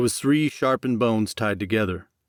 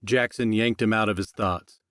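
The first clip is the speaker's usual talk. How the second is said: ordinary delivery, nothing marked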